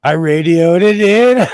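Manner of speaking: can't hold back the giggle while saying it; laughing. Real, not acted. Laughing through the line.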